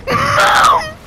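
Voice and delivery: high, loud scream of shock. Real, not acted. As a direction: shriek in sudden dismay. Sudden in-game shock.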